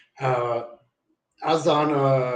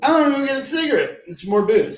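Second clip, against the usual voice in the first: giddy plan; 12 semitones higher, faster than usual. Retold or imitated. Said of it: "And then I was like".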